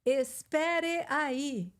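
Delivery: "Espere aí." said very slowly, each syllable clear; slow, over-careful. Shown as a demonstration. Teacher mocking the formal way nobody speaks. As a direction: spell out "Espere aí." stiffly, like a textbook. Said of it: "a gente não fala".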